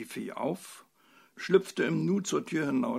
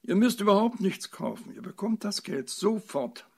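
high, loud, desperate insistence; 8 semitones higher, 8 dB louder than usual. A narrator's reading. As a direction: plead urgently to convince them. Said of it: "rief die Fee verzweifelt".

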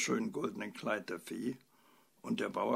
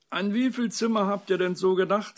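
loud, high suspicious question; 9 semitones higher, 10 dB louder than usual. A narrator's reading. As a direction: challenge them with wary distrust. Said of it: "fragte der Bauer misstrauisch".

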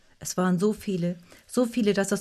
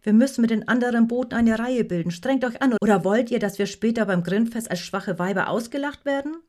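loud, higher, forceful urging; raised voice of a boat leader; rallying command; 4 semitones higher, 5 dB louder than usual. A narrator's reading. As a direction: goad the crew into more effort. Boat leader urges crew on.

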